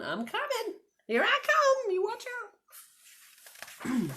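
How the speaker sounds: high, eager calling out; playful mama voice. Pretend. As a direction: play the mama rushing after bunny. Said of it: "there's Mama going".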